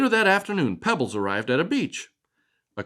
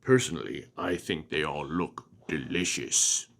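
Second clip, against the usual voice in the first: much lower, softer sinister monster; low hungry monster; 9 semitones lower, 7 dB quieter than usual. Acted. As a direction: purr it like a hungry beast. Creature jokes about eating humans.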